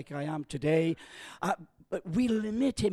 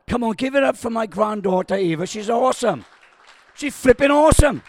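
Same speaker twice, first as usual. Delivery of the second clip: louder, higher, rising excitement; hyping the crowd; 5 semitones higher, 11 dB louder than usual. Real, not acted. Preacher calls the church to cheer his granddaughter, excited shout. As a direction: whip the congregation into applause.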